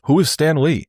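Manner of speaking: baffled sports radio blowhard; lightly imitated radio host brushing it off; Mike Francesa. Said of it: "And he just goes".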